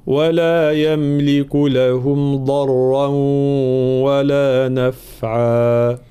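slow, chanted isti'adha; Quran recitation. Shown as a demonstration. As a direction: recite slowly for the class to repeat. (تلاوة بطيئة للتلقين)

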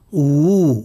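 و said as a full, lip-rounded wu; careful isolated wu sound for و; waw with damma. Shown as a demonstration. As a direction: round the lips fully and hold و as wu. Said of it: "المتحرك بالضم".

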